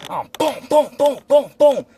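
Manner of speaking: high punchy booms; imitated gunshots. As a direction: fire off each boom like a shot.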